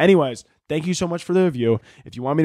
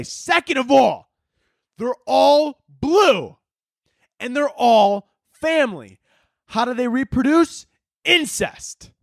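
much higher, slow, theatrical rant; mock outrage; 9 semitones higher, slower than usual. Real, not acted. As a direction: build a scandalized conspiracy rant, high and slow. Ranting about Smurfs.

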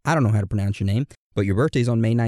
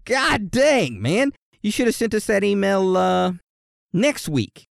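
mock exasperation; 8 semitones higher, slower than usual. Mock scolding a listener.

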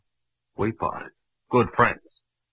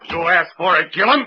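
loud, higher, lunging yell; shouted threat; 9 semitones higher, 6 dB louder than usual. Acted. Gillum shouting as he swings at Marlowe.

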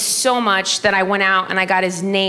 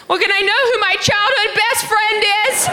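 very high mock mom wail; shrieky high-pitched mom imitation; indignant mom; 13 semitones higher than usual. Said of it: "And then my mom goes".

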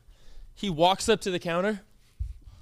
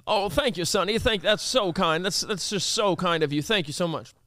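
ordinary delivery

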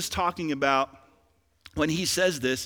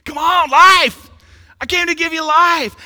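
high, very loud preacher's cry; rousing shout; 11 semitones higher, 14 dB louder than usual. Acted. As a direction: shout life into the congregation. Sudden shout after listless examples.

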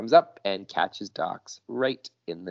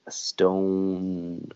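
plain reading voice

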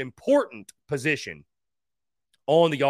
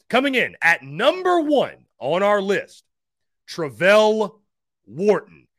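top ten countdown; 2 semitones higher, 4 dB louder, slower than usual. Real, not acted. Reveals number one pick.

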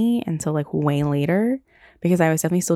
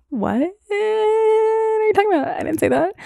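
much higher, slow, put-on innocent voice; mock innocent denial. Girl denying to boyfriend she said Bob, squeaky innocence. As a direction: play dumb in a squeaky voice.

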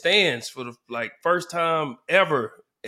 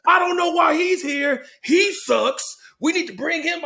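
high, loud hammed-up angry fan shout; furious mom fan; 11 semitones higher, 5 dB louder than usual. Angry mother fan shouting that the coach must be fired. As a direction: rant like an outraged mother in the stands. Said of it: "She's like".

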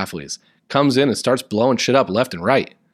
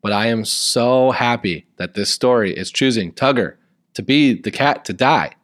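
story reading; 2 semitones lower than usual. A narrator's reading. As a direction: read the fan fiction like a dramatic storybook. Reads fan fiction aloud over music.

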